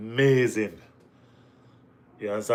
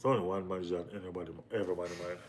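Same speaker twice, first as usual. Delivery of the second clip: quiet fast aside; 5 semitones lower, 12 dB quieter, much faster than usual. Real, not acted. Repeated as an aside.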